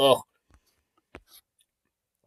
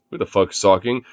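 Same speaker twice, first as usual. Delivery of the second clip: usual speaking voice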